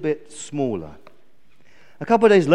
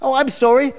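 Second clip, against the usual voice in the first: high, loud flustered sparrow; taken-aback little bird; apologetic; 10 semitones higher, 8 dB louder than usual. Acted. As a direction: chirp a quick apology. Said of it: "apologised the sparrow".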